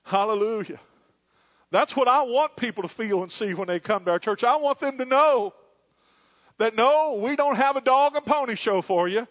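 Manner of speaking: loud, high preaching climax; full-force pulpit shout; fiery preaching. Acted. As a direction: lift the crowd, preaching hard.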